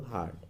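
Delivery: Hard as one single beat. Shown as a demonstration. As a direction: say hard in one unbroken syllable. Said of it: "आप एक बार में बोल दो". (Hard को एक ही बार में बोलें)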